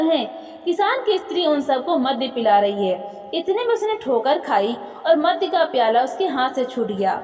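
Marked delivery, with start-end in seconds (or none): none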